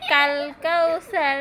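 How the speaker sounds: slow, drawn-out words with a small laugh; slow recitation. Shown as a demonstration. Mother teaching a child a surah, slow and laughing. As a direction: spell out each word for the child to copy. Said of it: "বলো, ইন্না".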